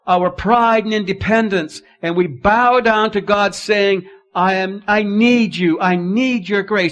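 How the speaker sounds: loud, high, full-force preaching. Acted. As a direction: preach it out to the congregation.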